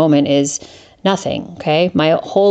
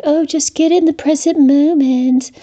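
much higher, prissy mock advice; mocking sing-song; 9 semitones higher than usual. Mocking the gurus, high sing-song parody of their advice. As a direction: parrot the advice with scorn. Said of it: "It was like".

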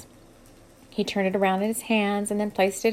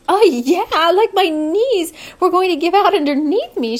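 much higher and louder, breathless delight; a swooning girl gushing about the kiss; Amber, giddy young woman; 8 semitones higher, 8 dB louder than usual. A narrator's reading.

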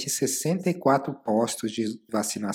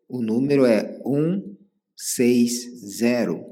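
slow clear repeat; slower than usual. Real, not acted. Repeating number for clarity.

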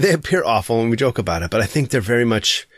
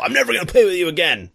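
mock kid taunt; 7 semitones higher, faster than usual. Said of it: "the things kids say to each other".

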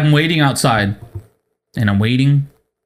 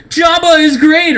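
much higher, louder and slower, sing-song; mock password yell; 13 semitones higher, 4 dB louder than usual. Mocking a secret knock at the smoothie shop, hammed-up yell. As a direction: belt the silly code word like a cheer.

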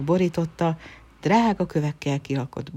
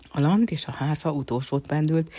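ordinary delivery, nothing marked

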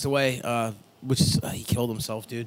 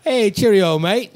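high, loud cartoon British greeting; mock British accent; 6 semitones higher, 7 dB louder than usual. Answering how British he is, mock British accent. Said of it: "as British as they fucking come".

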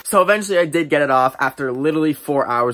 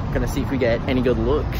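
ordinary talk

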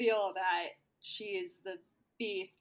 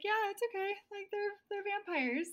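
high, awkward mock Stu; awkward reassurance; 12 semitones higher than usual. Stu calmly accepting the vampires, high and awkward. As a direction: shrug it off nervously, pitched up. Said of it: "he's like".

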